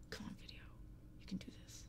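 soft unvoiced encouragement; whisper. Real, not acted. Streamer whispering encouragement to her video. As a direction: coax it gently in a whisper.

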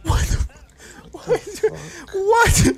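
stunned, building to a high what; escalating shocked what; rising disbelief. Real, not acted. Repeated disbelief at clip.

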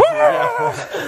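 squealing laugh, high-pitched; high burst of laughter. Real, not acted. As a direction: let out a high, delighted laugh. Laughs at reaching ten K.

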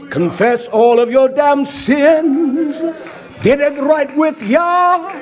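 slow, chant-like call to repent; preaching exhortation. Real, not acted. Preacher urging Yisrael to get right, drawn-out exhortation. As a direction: stretch each phrase out, building to a ringing cry.

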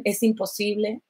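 hushed voice of doubt; quiet, hopeless quote. Retold or imitated. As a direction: murmur it like a discouraging voice. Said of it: "me decían".

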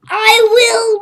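high, loud declaration; playful battle cry. Real, not acted. Gamer rooting for his ragdoll, loud mock battle cry. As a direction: declare it like a hero.

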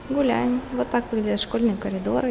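ordinary talk, nothing marked

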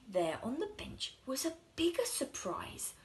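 plain reading voice

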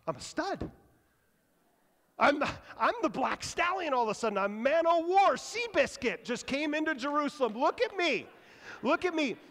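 high, loud, strutting braggart; a donkey showing off to the crowd; boastful donkey. Retold or imitated. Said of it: "the donkey thinks he's like".

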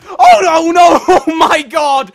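very loud, high wail; high, full-volume panic; horrified shout. Real, not acted. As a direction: cry out in horror. Sudden disaster after success.

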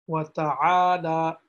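"ta'ala" from the throat, vowel drawn long; throat and stretch. Shown as a demonstration. Teacher summing up both rules, slow and throaty. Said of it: "saying it with your throat ... stretching it".

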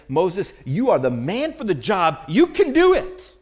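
hammed-up cheerleader hype rising high and loud; over-the-top mock encouragement; mock pep talk. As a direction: pump him up like a coach, tongue in cheek. Mock pep talk God didn't give.

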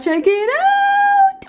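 stretched high, slow check it out; playful high squeal of check it out; sing-song high. Shown as a demonstration. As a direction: sing it out excitedly to viewers.

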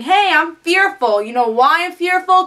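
lightly voiced leader rousing a crowd; speech voice. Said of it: "giving this speech saying".

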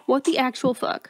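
usual speaking voice

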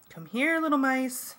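mildly projected, lifted call; said loudly. A narrator's reading. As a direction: call out as if coaxing little animals. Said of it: "Cassie said loudly".

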